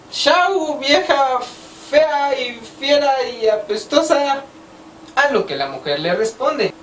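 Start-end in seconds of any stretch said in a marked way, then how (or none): 0.1-4.4 lightly voiced drunk, pitched higher; a hint of a tipsy drunk jeering at the woman. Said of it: "pasa un borrachito y le dice".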